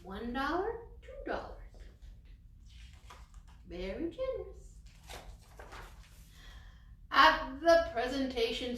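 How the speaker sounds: put-on lively storybook read for little kids; story reading. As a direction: read it aloud like to a class of children. Reading picture book to children.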